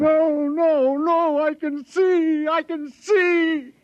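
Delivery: desperate insistence. Acted. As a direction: push back against the hands holding him down. Blind man insists he sees.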